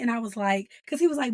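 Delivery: usual speaking voice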